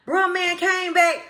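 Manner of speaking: ordinary talk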